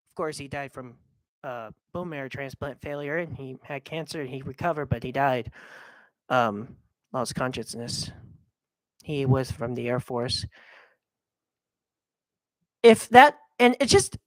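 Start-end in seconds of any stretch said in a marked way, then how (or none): 0.1-10.5 low, soft, subdued telling; quiet sad remembrance of grandpa; somber. Real, not acted. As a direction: let the voice sink with the loss. Sad talk of grandpa's death.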